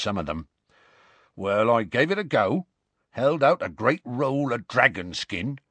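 rustic, lively storyteller in dialect; Hagrid recounting his journey. Acted. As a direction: tell the adventure in a rustic character voice. Hagrid tells his tale.

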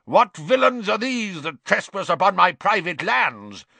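put-on loud, high old-fashioned knight; indignant knight. Knight confronting Harry, Ron and Hermione, loudly indignant. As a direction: bellow a challenge at trespassers.

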